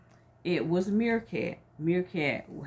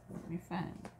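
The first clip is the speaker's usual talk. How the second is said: much softer, to herself; whispered aside; 14 dB quieter, faster than usual. Real, not acted. Teacher whispering while finding her place in the book. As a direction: whisper it while searching the page. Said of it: "whispered aside".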